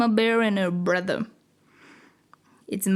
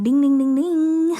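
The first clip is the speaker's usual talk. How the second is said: loud, high ringing ding like an alarm bell; bright alarm ding-ding; 4 semitones higher, 5 dB louder than usual. Acted. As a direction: chime it out like a theory alarm going off. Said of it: "there comes the theory alarm".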